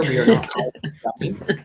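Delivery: normal talking voice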